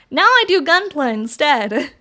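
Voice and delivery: usual speaking voice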